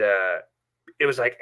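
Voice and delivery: ordinary delivery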